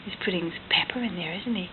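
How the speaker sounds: quick soft whisper. A narrator's reading. Parent telling toddler about the pepper, in a whisper. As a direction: breathe it out quietly, quick. Hushed story reading to child.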